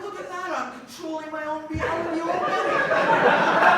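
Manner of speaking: slow proud laughing boast; smug amazement. Retold or imitated. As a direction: gloat at your own power. Proud of controlling reality.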